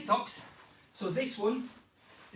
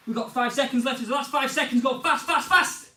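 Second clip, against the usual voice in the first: louder and higher to a shouted last fast; building urgent shout; 5 semitones higher, 8 dB louder than usual. Real, not acted. Coach yelling at players for the final five seconds, building shout. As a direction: drive them through the last seconds.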